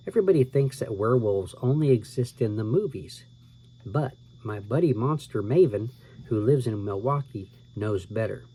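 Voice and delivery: usual speaking voice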